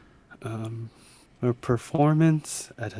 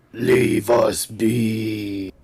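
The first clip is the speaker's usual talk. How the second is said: dragon voice; 2 semitones lower, much slower than usual. Pretend. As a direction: speak it slowly like a great dragon. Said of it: "I want to imitate the dragon's voice".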